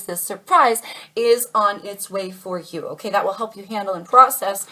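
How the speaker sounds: ordinary delivery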